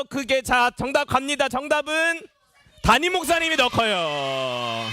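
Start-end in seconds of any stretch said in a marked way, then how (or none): 2.8-4.9 drawn-out answer reveal. Real, not acted. Host tells children the pastor is taller, drawn-out reveal. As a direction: stretch the last word out long.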